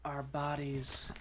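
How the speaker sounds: mock meditation voice. Mock meditation about souls detaching.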